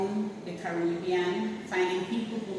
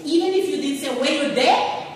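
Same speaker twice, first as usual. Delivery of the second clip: excited retelling; 8 semitones higher, 9 dB louder than usual. Retold or imitated. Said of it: "she was like".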